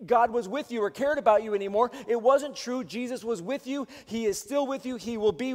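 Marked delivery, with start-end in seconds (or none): none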